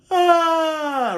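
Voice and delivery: high, loud drawn-out yawn; fake yawn. Shown as a demonstration. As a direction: let all your breath out in a yawn. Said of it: "I will also yawn".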